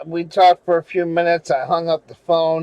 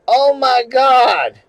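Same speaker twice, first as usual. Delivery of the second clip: slow, louder and higher gasp of "oh my God"; retold shocked cry; 6 semitones higher, 5 dB louder, slower than usual. Mother seeing the towers on TV, slow shocked cry. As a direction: let the shock drag out the words. Mother sees plane hit on TV.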